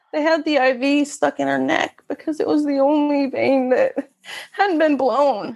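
fighting back sobs as she tells it; crying while describing the hospital scene. Real, not acted. Describing daughter in the emergency room.

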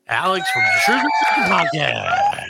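announcer shout. Pretend. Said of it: "Alan, you do the thing".

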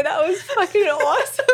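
high, wheezing laugh trailing off; hysterical laugh. Real, not acted. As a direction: still cracking up, tears of laughter. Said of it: "tears of laughter".

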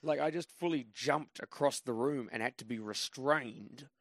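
usual speaking voice

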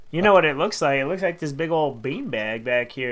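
usual speaking voice

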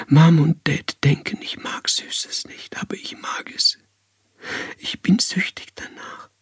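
high, thin, desperate whisper; soft breathy whisper climbing into a helpless plea; whispered confession. A narrator's reading. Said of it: "flüsterte Marlene Dietrich die Wahrheit ins Ohr".

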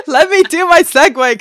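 words squeezed out through high, loud laughter; real laughter breaking through the line; laughing. Real, not acted. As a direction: try to talk while cracking up. Laughing fit over the segue.

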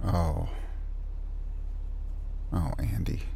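low, very soft murmur; hushed, low and compassionate; tender sympathy. Acted. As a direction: console a hurting friend gently. Consoling the upset friend.

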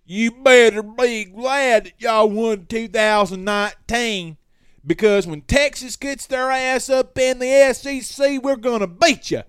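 mocking Texas fan taunting in the DMs, high and loud; cocky rival fan impression. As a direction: ham up the smug trash talk, stretching it out. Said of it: "This is how they talk".

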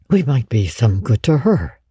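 ordinary delivery, nothing marked

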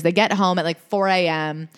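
ordinary delivery

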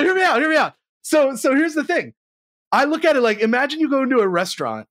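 usual speaking voice

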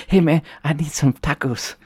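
lightly voiced whiny creature; a hint of a stoner Chupacabra. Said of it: "Chupacabra's like".

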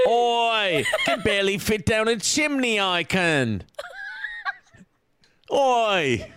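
hammed-up Cockney British accent with a shouted oi; cheeky British sweep stuck in a chimney, in accent; mock Cockney accent with an oi call. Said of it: "apparently he's British".

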